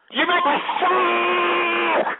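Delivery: slow, high, loud furious shout; real rage boiling over on air; angry outburst. Real, not acted. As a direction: spit it out in disgust. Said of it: "I'm getting a little angry here".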